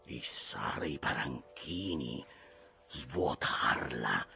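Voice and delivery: low, very slow disbelieving repeat; incredulous echo. A narrator's reading. Ahab throws the officer's proposal back at him, slow disbelief. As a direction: drag out each word in disbelief.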